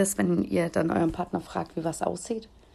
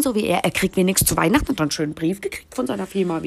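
normal talking voice

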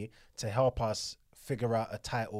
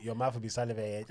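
ordinary talk

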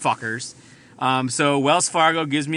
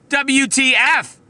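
incredulous outburst; 10 semitones higher, 5 dB louder, much slower than usual. Real, not acted. Outraged at botched surgery.

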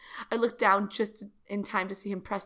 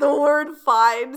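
squealed; 6 semitones higher, 9 dB louder, much slower than usual. Acted. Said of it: "sounded as if I had squealed it".

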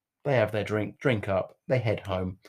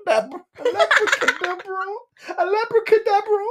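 giggling punchline. Real, not acted.

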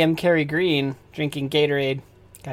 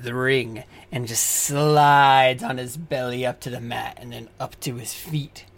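low scene narration; 5 semitones lower, 4 dB quieter than usual. A narrator's reading. DM narrates entrance.

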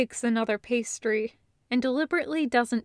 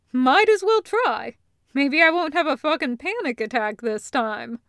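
loud, higher, offhand agreement; Taco, casual; 3 semitones higher, 6 dB louder than usual. Acted. Taco agreeing to the arm check, loud and offhand. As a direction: toss it off like a tough guy. Taco's brash reply.

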